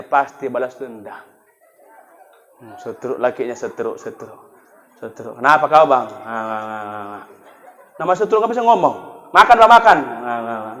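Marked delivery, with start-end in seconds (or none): 2.6-7.2 low, soft, slurred mumbling; stroke-stricken husband. Acted. 10.0-10.7 low, soft, slurred mumbling; stroke-stricken husband. Acted.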